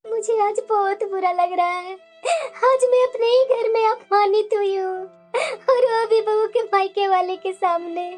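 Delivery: crying lament with sobbing breaths; tearful hurt. Acted. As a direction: pour out your humiliation through tears. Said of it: "तुम्हारी आंखों में आंसू क्यों है". (रोते हुए, आहत स्वर में)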